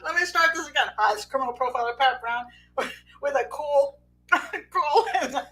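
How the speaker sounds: trying to be composed, cracking up mid-sentence; real corpsing on the punchline; stifled laughter. Real, not acted. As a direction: restart the intro with giggles leaking through. Restarts intro while still laughing.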